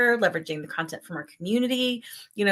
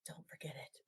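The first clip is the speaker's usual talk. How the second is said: hushed reminder to self; whisper; 28 dB quieter than usual. Retold or imitated. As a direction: whisper it under your breath. Inner thought whispered.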